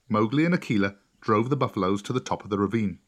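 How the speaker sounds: ordinary delivery, nothing marked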